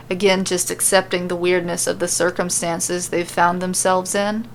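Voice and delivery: ordinary delivery, nothing marked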